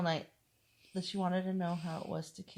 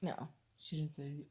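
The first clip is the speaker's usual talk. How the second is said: ordinary talk, nothing marked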